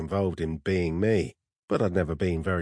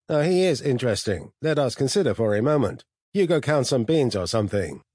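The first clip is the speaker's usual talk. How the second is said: higher-pitched, scornful superior intelligence; haughty, disdainful computer; 6 semitones higher, 4 dB louder than usual. Acted. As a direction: lecture a lesser species, swelling into self-admiring rapture. Narrator voices the disdainful steel sphere.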